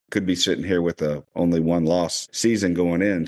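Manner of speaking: normal talking voice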